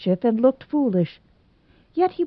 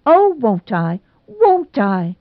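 high, louder eager yes; a girl accepting a proposal on the spot; shameless cry; 4 semitones higher, 4 dB louder, slower than usual. A narrator's reading. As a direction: gush it out with no shame. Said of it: "cried Nora, so shamelessly".

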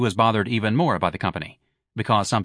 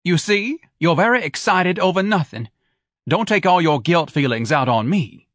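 higher, louder nagging tirade; Mrs Levy heated; 7 semitones higher, 4 dB louder than usual. Acted. Wife berates husband for wasting the family business, higher and louder. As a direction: pile the accusations on him.

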